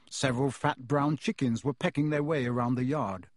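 usual speaking voice

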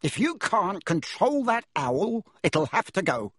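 a hammed-up roar, pitched high; furious uncle demanding the owl go. A narrator's reading. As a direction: bark the threat at the boy. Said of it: "he roared across the table".

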